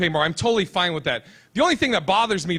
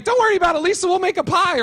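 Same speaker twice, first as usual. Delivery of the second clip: high, louder mock girly voice; exaggerated girlish reassurance; girl comforting friend; 11 semitones higher, 4 dB louder than usual. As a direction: imitate a little girl consoling, voice way up. Voices a girl consoling her friend.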